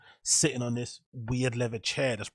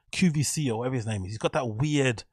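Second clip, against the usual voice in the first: ordinary talk, nothing marked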